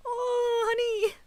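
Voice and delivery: high, loud, emotional "oh, honey!" squeal; touched squeal. Real, not acted. Streamer squealing at chat's sweet message, overwhelmed. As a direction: gush as if about to cry.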